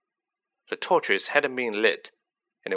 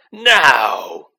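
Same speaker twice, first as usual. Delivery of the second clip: much louder sudden yell; 10 dB louder than usual. A narrator's reading. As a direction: yell the command hard.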